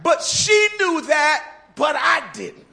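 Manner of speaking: usual speaking voice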